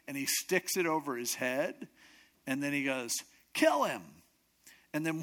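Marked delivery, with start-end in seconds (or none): 3.5-4.0 high, loud order; acted royal death sentence, pitched up; angry command. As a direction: order it in a burst. Angry king orders execution.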